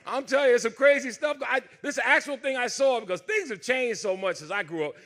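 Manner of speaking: normal talking voice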